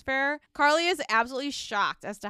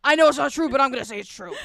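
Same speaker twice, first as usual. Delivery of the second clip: Joe Rogan impression; 5 dB louder, faster than usual. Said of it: "We just become Joe Rogan".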